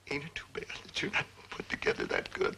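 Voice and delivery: unvoiced whispered jab; whispered aside. Real, not acted. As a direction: whisper it behind his back. Dad's aside about his son.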